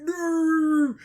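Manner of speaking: high, drawn-out wail of defeat; exaggerated high-pitched "no" in mock agony; mock anguished cry. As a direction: cry out like a hammed-up dying fighter. Acting out a defeated fighter's cry.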